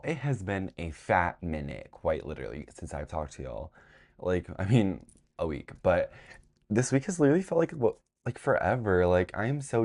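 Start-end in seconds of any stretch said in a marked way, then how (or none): none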